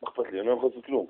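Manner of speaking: a lifer who's given up, mumbled; indifferent shrug. Retold or imitated. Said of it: "הוא אומר". (אסיר עולם אדיש, נמוך ושקט)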